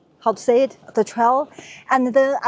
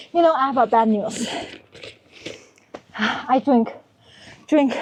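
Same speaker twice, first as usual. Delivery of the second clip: ordinary delivery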